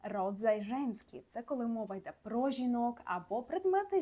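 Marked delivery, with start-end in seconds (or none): 0.0-1.2 Polish "род займенський" spelled out slowly; slow Polish term. Shown as a demonstration. Teacher spelling out the Polish term for students, slowly. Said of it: "Жіночий рід —".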